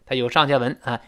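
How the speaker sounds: ordinary delivery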